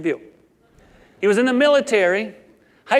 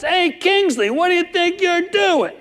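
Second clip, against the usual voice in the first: higher, louder taunting challenge; mouthy little bully; 8 semitones higher, 4 dB louder than usual. Retold or imitated. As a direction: play the cocky small kid picking a fight. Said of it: "always so mouthy".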